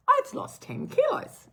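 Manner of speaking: Ma's voice. Retold or imitated. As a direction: lightly play the happy older friend. Voicing Ma in phone chat.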